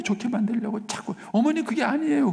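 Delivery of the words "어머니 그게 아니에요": retold protest, much higher pitch; acted protest. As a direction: plead back at her in a raised, high voice. Arguing with his mother, acted. (어머니께 항변하듯 높은 목소리로)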